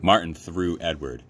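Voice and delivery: ordinary delivery, nothing marked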